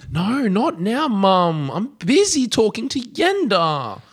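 put-on high, slow whiny child voice; whiny kid. Dick brushing off his mum while on the phone, high whiny.

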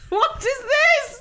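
laughing squeal. Real, not acted. Laughing high wail.

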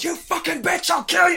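high, furious screaming threat; evil doll shout. Acted. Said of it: "And it just goes".

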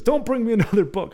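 high, loud mock objection; retold protest. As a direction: protest loudly in an imitated voice. Acted protest from the lady.

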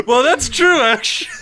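laughing through the words; real laughter, words breaking up. Real, not acted. Bursts out laughing at own joke.

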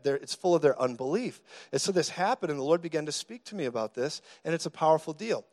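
usual speaking voice

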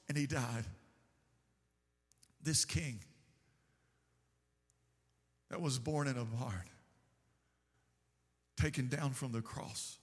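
hushed preaching. Acted. Preacher to congregation, mourning Jesus' death, low hushed voice. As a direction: drop to a near hush as if at a graveside.

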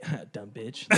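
low, very soft retold grumble; muttered insult. Patient reacting to dentist saying use your eyes, muttering. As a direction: grumble it under your breath, amused.

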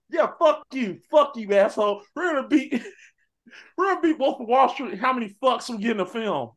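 high, laughing rowdy boast; Blades boasting together. Retold or imitated. The Blades bragging to the audience, high and laughing. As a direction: brag about the cussing with a laugh. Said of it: "he said".

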